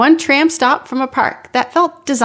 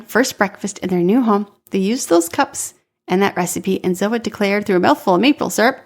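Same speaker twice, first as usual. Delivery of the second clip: usual speaking voice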